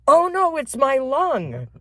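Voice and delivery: put-on high, slow wail of a panicked little girl. Girl caught with the rotting lung, high slow panic. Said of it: "what I said when I ran in there was".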